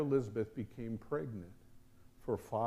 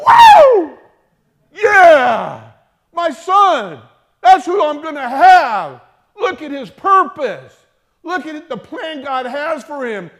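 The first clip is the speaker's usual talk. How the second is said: whooping, much higher and louder; ecstatic cheering; 13 semitones higher, 22 dB louder, slower than usual. Pretend. Preacher imagining Zechariah's joy, whooping and shouting. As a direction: shout for joy like a new father. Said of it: "That's how he should have responded".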